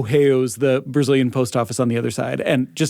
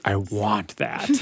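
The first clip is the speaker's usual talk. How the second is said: quoted want; 6 semitones lower, 6 dB quieter, slower than usual. Retold or imitated. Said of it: "being like".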